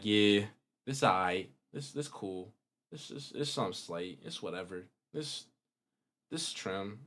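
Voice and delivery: low, very soft too-cool mumble; nonchalant. Acted. Creator mimicking a boring guy online, nonchalant. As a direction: play the guy too cool to care, barely voiced. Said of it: "trying to be nonchalant".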